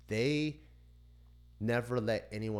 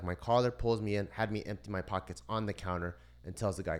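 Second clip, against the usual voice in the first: ordinary talk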